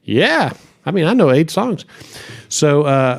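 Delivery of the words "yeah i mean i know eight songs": eager yes. Retold or imitated. Eager reply, retold.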